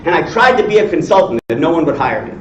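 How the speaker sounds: ordinary talk, nothing marked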